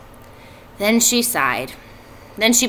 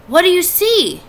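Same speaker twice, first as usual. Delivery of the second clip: curious pig; 10 semitones higher, 4 dB louder than usual. A narrator's reading. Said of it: "asked Wilbur".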